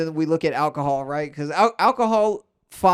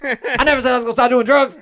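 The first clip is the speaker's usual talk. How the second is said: loud indignant yell, pitched up; indignant shout; 6 semitones higher, 5 dB louder, faster than usual. Retold or imitated. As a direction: bark it back in disbelief. [name] retold in mediation.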